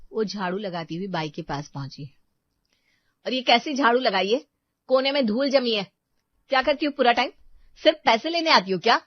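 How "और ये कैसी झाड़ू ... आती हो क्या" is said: loud, higher fast scolding; angry nagging. Acted. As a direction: pick at every fault, harshly. Seema berates maid.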